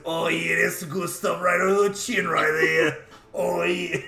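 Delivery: Guido voice. Pretend. Game master imagines the scratched dragon, exaggerated Guido voice. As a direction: ham it up as a mock Guido. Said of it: "like, a Guido voice".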